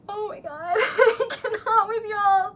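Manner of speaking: tearful laugh; overwhelmed joyful tears; laughing through tears. Real, not acted. Laughing crying.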